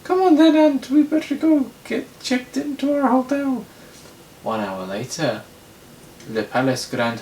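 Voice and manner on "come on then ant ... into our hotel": very high, loud falsetto character voice; piercingly high cartoonish put-on voice; Anthony Falcon. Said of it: "Anthony Falcon".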